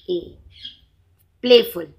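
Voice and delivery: normal talking voice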